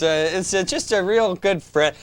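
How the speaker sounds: ordinary talk, nothing marked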